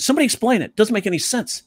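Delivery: high, baffled mini-rant; Bill Burr impression. Teacher imitating a comic ranting from his point of view, high baffled complaint. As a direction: pitch it up, exasperated and lost. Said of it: "he's ranting about it".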